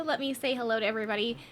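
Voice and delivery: ordinary delivery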